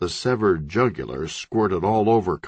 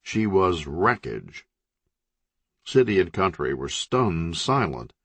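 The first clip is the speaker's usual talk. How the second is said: ordinary delivery, nothing marked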